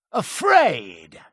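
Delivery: indignant outburst falling at the end; indignant exclamation. Acted. Accused of being afraid, indignant retort. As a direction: throw the word back, affronted.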